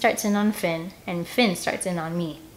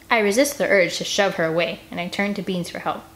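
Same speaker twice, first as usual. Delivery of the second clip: usual speaking voice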